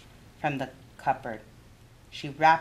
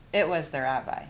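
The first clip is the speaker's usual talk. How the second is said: ordinary delivery, nothing marked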